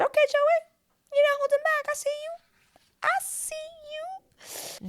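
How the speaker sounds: high, squeaky sing-song teasing; high mock-knowing "I see you". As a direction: tease him in a tiny high voice. Teasing Joey about holding back.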